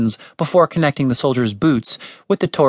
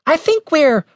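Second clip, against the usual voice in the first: very high, anxious squeak. A narrator's reading. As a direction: pipe it up until cut off. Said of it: "Why was his voice so high?".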